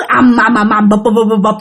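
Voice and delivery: rapid run of thuds; imitated banging. Storyteller imitating banging inside the headboard, rapid repeated thuds. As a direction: drum out the knocking on the bed box. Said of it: "cái tiếng gõ vô trong".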